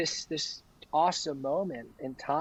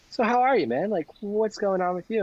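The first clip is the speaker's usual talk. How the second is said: higher, lightly acted check-in; retold chatty doctor, pitched up; lightly voiced friendly doctor; 6 semitones higher, 3 dB louder than usual. Doctor checking in on patient, lightly voiced friendly question.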